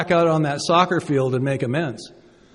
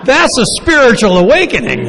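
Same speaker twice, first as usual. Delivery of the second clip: excited emphasis; 7 semitones higher, 8 dB louder than usual. Real, not acted. Excited exclamation.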